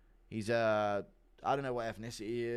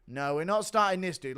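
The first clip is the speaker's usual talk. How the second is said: DDP impression; 7 semitones higher, 6 dB louder than usual. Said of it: "Impression of D D P running to kiss his coach".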